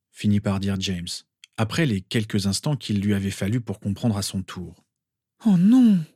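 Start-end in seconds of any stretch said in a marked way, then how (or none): 5.5-6.0 high, loud wail of dismay; moaning. A narrator's reading. Mother realises what happened to Harry, moaning in dismay. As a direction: wail it as the truth sinks in. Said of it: "gémit Lily".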